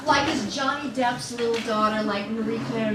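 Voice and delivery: ordinary talk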